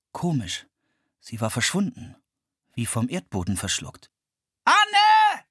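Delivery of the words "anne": loud high call across the river. A narrator's reading. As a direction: shout her name as loud as possible. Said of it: "rief er so laut er konnte".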